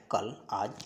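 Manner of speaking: ordinary talk, nothing marked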